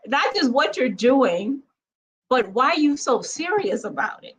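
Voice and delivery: ordinary talk, nothing marked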